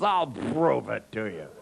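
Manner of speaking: put-on slow, slurred drunk boast; drunk man. Drunk man out to prove himself to the guard, slurred and slow. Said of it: "still blasted out of their head".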